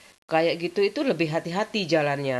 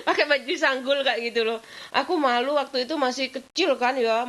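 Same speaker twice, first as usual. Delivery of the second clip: talking through laughter, higher; laughing; 9 semitones higher than usual. Real, not acted. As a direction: laugh while telling it, embarrassed. Embarrassed memory, laughing.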